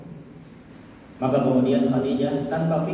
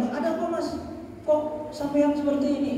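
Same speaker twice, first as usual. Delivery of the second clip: much higher, anxious rush of questions; the wrong way, a wife panicking at her trembling husband; alarmed questioning; 10 semitones higher, 4 dB quieter than usual. Retold or imitated. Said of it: "kalau orang digituin gimana? Semakin takut".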